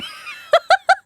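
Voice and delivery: short shrill burst of laughter; high giggle; high squealing laugh. Real, not acted. Laughs at professor's question.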